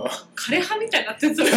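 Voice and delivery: laughs through the line, loud burst after; laughing. Real, not acted. Retelling the senior's jab, breaking into laughter. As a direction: crack up while quoting the teasing.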